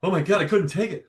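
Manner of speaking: high, loud whiny protest; mock whiny kid. Kid complaining to the dentist, high whiny protest. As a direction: whine it like a scared kid. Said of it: "I was".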